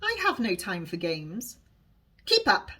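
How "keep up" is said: high, sharp scolding call; scolding Alice. A narrator's reading. Alice scolds the Welsh girl to hurry. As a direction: snap at the slow girl to keep up. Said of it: "I scold her".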